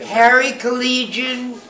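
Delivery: calling out. Real, not acted. Calling out to husband in audience.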